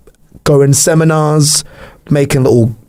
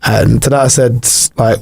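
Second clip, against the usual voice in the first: normal talking voice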